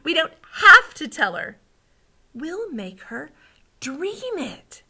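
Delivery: high, eager reveal; delighted child character; excited. A narrator's reading. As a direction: build to the big idea, pausing before the punch. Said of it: "Sophie said excitedly".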